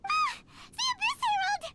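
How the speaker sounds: alarmed. Acted. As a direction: blurt it out in shock. Paimon recognizes the villain, alarmed.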